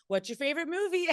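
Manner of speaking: 3 brightly try to cheer up a crying baker; 4 host distracting a crying contestant, mock chirpy; 1 forced cheerful host. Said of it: "and I'm like".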